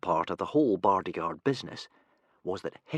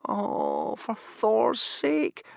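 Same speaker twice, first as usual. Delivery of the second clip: slow, drawn-out despair; miserable groan; 13 semitones higher, much slower than usual. A narrator's reading. Hiccup dreading the fight, groaning miserably. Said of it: "groaned Hiccup miserably".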